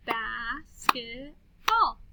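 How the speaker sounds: BASKETBALL very slowly, bas-ket-ball; slow syllable-by-syllable "Bas-ket-ball"; clapped syllables. Shown as a demonstration. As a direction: stretch "Basketball" into three separate beats. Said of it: "then we'll clap the syllables".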